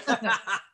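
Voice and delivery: a short bright laugh that falls away; quick real laugh. Real, not acted. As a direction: genuine amused laugh, a little high. Laughs after his own reaction.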